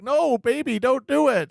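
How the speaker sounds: high-pitched mock begging; mock pleading. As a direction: plead like a hammy movie victim. Retelling a pleading movie character.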